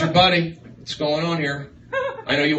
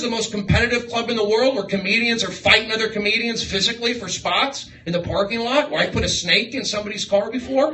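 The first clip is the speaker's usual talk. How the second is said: higher, louder comic tirade; mock indignant rant; 8 semitones higher, 4 dB louder than usual. Comedian ranting at a crossed-arms guy, mock anger. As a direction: puff up and lecture him on comedy. Roasting audience member's comedy credentials.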